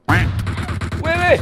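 sudden loud high yelp; teriak kaget. Real, not acted. Tiba-tiba diserang. (teriakan kaget keras)

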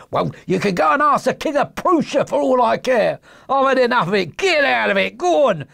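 high-pitched exasperated shouting; angry dismissal. Acted. Salesman driving off the little man, angry. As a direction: send him packing, thoroughly fed up. Salesman drives the pesterer away.

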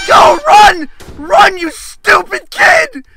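loud, urgent yell at the character; panicked shouting. Real, not acted. Player yelling at the kid to run, panicked shouting. Urging game character to flee.

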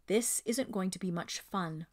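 ordinary delivery, nothing marked

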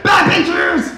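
put-on high shouted child warning; mock child alarm; kid shouting. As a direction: yell it like a kid spotting a billboard. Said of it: "Joey's like".

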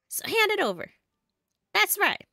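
high-pitched cartoon demand; bossy game henchman.

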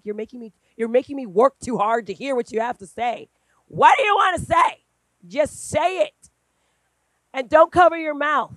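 loud, high, insistent push to speak up; firm scolding. Retold or imitated. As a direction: raise the voice to make her speak out. Scolding her whispering niece.

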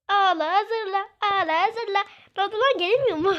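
mocking taunt. Gamer taunting the crying grandpa, a loud singsong jeer.

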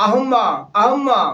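teacher demonstrates মা as আহম্মা, slowly; ma pronounced ahamma. Shown as a demonstration. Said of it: "আর মার উচ্চারণ কি?". (মা-এর উচ্চারণ ধীরে আহম্মা বলে দেখানো)

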